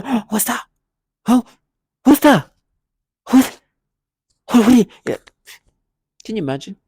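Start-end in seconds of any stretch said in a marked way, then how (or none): 0.0-5.2 loud high hammed-up what's that; pretended surprise. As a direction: overplay the fake shock. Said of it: "Try to pretend like".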